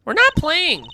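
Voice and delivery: high-pitched protest. Acted. Kid objecting to the officer who shoos them away, high protest.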